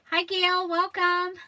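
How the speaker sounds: high, loud, bright hello; loud greeting. Real, not acted. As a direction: call out a cheerful welcome. Greeting a live viewer.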